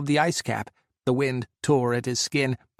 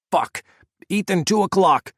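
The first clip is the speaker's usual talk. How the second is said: loud, higher alarmed shout; 6 semitones higher, 6 dB louder than usual. A narrator's reading. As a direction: yell the warning to Ethan. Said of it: "Scott shouted".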